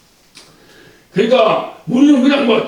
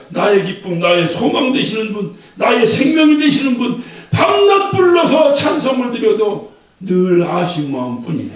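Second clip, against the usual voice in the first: usual speaking voice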